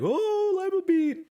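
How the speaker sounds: high, loud teasing singsong; mocking siblings. Siblings shoving lima beans in his face, high teasing. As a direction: taunt the kid with the bean. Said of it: "they would like".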